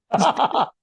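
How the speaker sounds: sudden high laugh bursting out; real startled laugh; burst of laughter. Real, not acted. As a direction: laugh out in disbelief. Laughing at doctor's insult.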